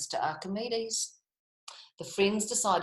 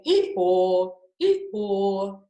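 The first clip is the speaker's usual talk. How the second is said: loud braying; loud donkey hee-haw; 7 dB louder, much slower than usual. Acted. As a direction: bray like a donkey. Donkey sound.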